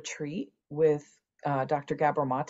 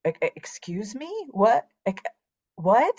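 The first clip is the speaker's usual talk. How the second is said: stammering shock; 6 semitones higher than usual. Retold or imitated. Hearing her father hid the adoption.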